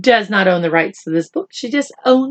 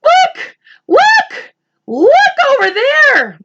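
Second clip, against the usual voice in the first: very high, louder shrieks; shouting; 4 dB louder, slower than usual. Acted. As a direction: shriek and point over there. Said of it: "shouted the ladybug".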